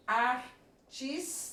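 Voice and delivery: "artista" broken slowly into ar, tis, ta; "artista" in slow, detached syllables; split into syllables. Shown as a demonstration. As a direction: separate each syllable of "artista" with a gap. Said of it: "O R com o T acontecem em sílabas diferentes".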